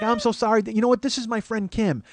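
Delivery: high-pitched Wisconsin woman impression; Wisconsin accent. Old schoolmate introducing her friend Kim, Wisconsin accent. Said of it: "she's like, very Wisconsin".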